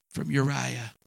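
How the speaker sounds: ordinary delivery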